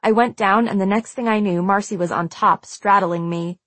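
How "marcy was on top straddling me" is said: ordinary delivery, nothing marked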